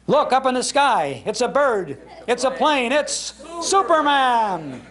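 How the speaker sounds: rising, slowing to a big "it's Superman"; hammed-up radio announcer, high and excited; dramatic radio announcer. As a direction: build it like the old Superman opening. Recreating the Superman radio opening.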